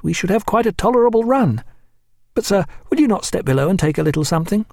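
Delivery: lightly voiced keen young officer. A narrator's reading. Reed voiced in narrated dialogue.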